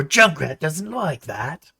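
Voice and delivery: high, slow crazed voice; crazy Junkrat speaking of himself. Retold or imitated. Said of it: "Junkrat voice".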